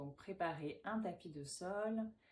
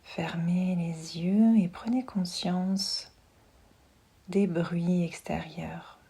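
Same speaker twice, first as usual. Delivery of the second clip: guided meditation; 14 dB louder than usual. Acted. Yoga teacher settling listeners lying down with eyes closed, hushed meditation voice. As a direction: guide them gently into relaxation, unhurried and close.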